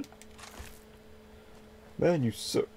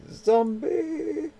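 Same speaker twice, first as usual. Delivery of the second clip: high alarmed call; startled cry; 12 semitones higher than usual. Real, not acted. Player spotting a zombie, high startled call. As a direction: spot it and yell.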